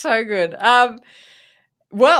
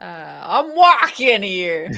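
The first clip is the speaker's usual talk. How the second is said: slow, drawn-out New York caricature; mock New York accent, laughing; 3 semitones lower, 4 dB louder, slower than usual. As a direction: draw it out like a New Yorker. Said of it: "do your New York accent".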